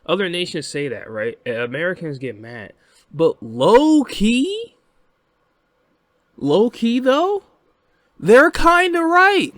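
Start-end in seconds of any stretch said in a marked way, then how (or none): 3.1-9.5 very high, slow, drawn-out squeal; teasing admission. Acted. Admitting to his viewers that critics are right, in a high drawn-out squeal. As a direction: stretch it out high and loud, teasing the audience.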